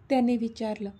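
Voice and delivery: plain reading voice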